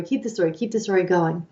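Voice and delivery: ordinary delivery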